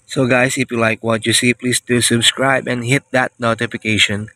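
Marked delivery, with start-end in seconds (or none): none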